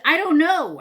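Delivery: high, loud frantic retort; exasperated panic, thrown out loud; panicked reply. Retold or imitated. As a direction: act out a flustered, frightened answer. Acted panicked answer to husband.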